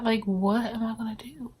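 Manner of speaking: hushed desperate whisper to God; whispered prayer. Retold or imitated. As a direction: breathe the prayer out, almost no voice. I was praying.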